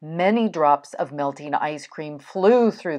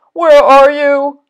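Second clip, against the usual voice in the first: loud, high searching call; storybook holler; crying out; 10 semitones higher, 13 dB louder than usual. A narrator's reading. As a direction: call out desperately for a lost friend. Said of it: "cried the thing".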